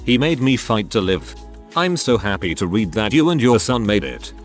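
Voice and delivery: usual speaking voice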